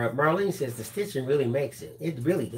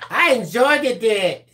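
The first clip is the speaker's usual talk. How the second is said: put-on high, loud childish voice; baby talk; 5 semitones higher, 6 dB louder, slower than usual. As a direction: coo it like a little kid. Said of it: "baby talking to yourself".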